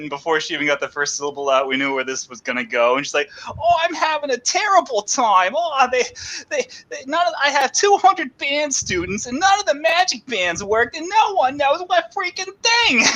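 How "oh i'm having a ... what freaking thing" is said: high, whiny complaining rant; complaining Boston lady. Retold or imitated. Mimicking the stranger on the riverboat venting about her band trip, high and whiny. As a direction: put on a grumbling tourist who is fed up. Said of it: "she had this thick Boston accent".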